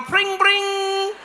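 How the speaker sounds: high, ringing sing-song bell; happy bike bell. Acted. Cheerful cyclist with the little bell, high silly ringing. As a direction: trill it out like a playful bicycle bell. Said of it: "You're there with the little bell".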